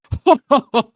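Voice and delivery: high, loud character chuckle; put-on gleeful laugh; acted giggle. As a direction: giggle like a mischievous rascal. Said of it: "giggles, like, he laughs".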